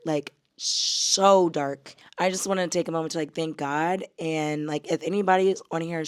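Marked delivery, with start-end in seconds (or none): 0.1-1.9 "so dark" stretched slow and heavy; heavy drawn-out so. Real, not acted. To viewers about last year's journals, slow and heavy. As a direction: lean hard on "so" in "so dark", dragging it.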